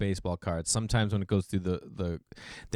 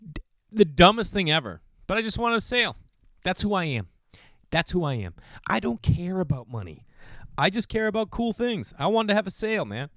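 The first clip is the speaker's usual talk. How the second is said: self-mocking rant; 9 semitones higher than usual. Real, not acted. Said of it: "exasperated at himself".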